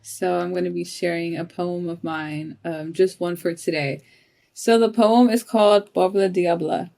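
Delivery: ordinary talk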